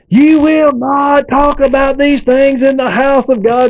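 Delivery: high, slow righteous scold; outraged rebuke. Retold or imitated. Old man shaking his Bible at the speaker, high slow outraged rebuke. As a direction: thunder the rebuke like a preacher.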